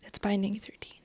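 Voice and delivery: hushed, breathy whisper of the title. Shown as a demonstration. Telling her viewers the book title, in a whisper. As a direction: whisper it like a secret.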